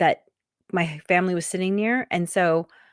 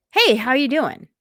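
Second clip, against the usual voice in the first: calling out; 7 semitones higher, 5 dB louder than usual. Retold or imitated. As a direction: call out high and loud across water. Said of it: "I hear a voice".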